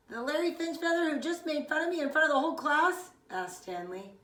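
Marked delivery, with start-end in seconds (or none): 0.1-3.1 high, fast, louder indignant question; indignant disbelief. Acted. Boy confronting the friend who mocked him, fast and high. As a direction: fire it back, hurt and offended.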